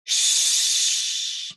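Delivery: sh hissed out unvoiced and long; sh sound. Shown as a demonstration. Teacher demonstrating sh to class, airy hiss. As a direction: push air through for sh. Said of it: "Now make that sound for S H".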